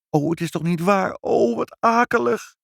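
high-pitched, aghast exclaiming; dismayed. Acted. Mother understanding the cats' bad news, aghast.

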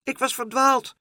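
put-on high excited child's voice; proudly pointing out his home; little boy. A narrator's reading. Little boy shows Pluk his door, high and excited.

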